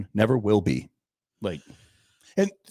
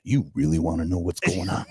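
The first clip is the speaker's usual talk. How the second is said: catchphrase voice; 6 semitones lower than usual. Acted. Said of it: "my catchphrase".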